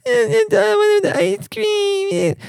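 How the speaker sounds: put-on very high, very slow whining child's voice; whiny kid. Sulky kid whining back at his parents, high and slow. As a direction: whine it out like a sulky little kid. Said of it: "The kid would be like".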